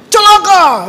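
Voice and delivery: high, loud cry of condemnation; hammed-up furious preacher calling down woe; mock angry preaching. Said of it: "Sedap kalau marah-marah orang". (pura-pura berkhotbah marah)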